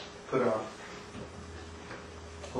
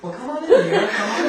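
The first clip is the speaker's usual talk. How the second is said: big, loud, high welcome; delighted greeting; 12 semitones higher, 9 dB louder than usual. Acted. Host welcoming old sweetheart, loud and delighted. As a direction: throw the door open with joy.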